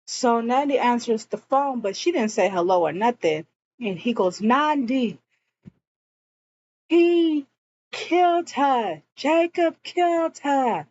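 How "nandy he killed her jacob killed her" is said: high, urgent, alarmed calling out; frantic alarm. Retold or imitated. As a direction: blurt it out in a panic. Frantic call revealing the killer.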